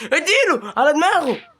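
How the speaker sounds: high, loud and slow egging on; excited urging. Real, not acted. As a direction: cheer the blow on. Urging the hit on the king. (تشجيع متحمس عالي)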